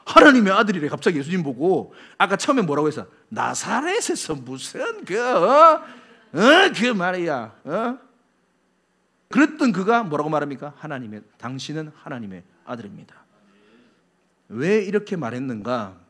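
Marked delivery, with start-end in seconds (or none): 3.3-8.0 mock rude. Nathanael first scoffing about Nazareth, mock rude. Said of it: "아까 버릇없게 행동하다". 10.8-13.2 much lower, near-whispered admission; hushed confession. Acted. Nathanael now confessing the Son of God, hushed.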